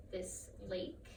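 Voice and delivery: ordinary delivery, nothing marked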